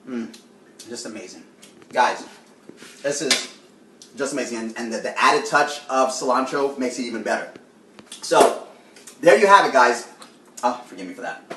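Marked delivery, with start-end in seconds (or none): 0.1-4.6 talking through a mouthful; chewing while talking; mouth full. Real, not acted. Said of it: "I shouldn't be talking with my mouth full". 10.6-11.4 mouth full. Real, not acted. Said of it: "I shouldn't be talking with my mouth full".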